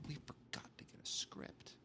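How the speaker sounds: unvoiced whispered aside. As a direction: whisper it like a guilty secret. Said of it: "people realize".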